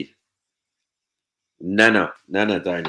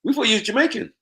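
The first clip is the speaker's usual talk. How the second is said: mock surprise; 4 dB louder than usual. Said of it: "they were like".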